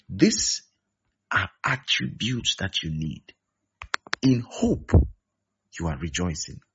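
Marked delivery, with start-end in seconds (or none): none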